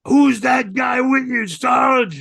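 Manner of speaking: high slow shout from the crowd; crowd yelling. Retold or imitated. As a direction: yell it across the arena. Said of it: "the whole crowd starts yelling".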